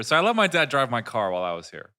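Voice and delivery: normal talking voice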